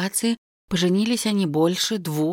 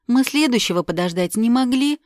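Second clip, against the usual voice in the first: higher reproachful question; 7 semitones higher, 6 dB louder than usual. Acted. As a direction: scold him for rushing. Heroine scolds him.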